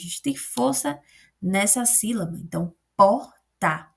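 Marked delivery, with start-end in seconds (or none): 3.0-3.9 "porta" split, POR... ta; stress on first syllable. Shown as a demonstration. Teacher shows where the force falls, "porta" with punched "por". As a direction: say "porta" slowly with a loud "por", pause, then "ta". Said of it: "Por é a minha sílaba tônica".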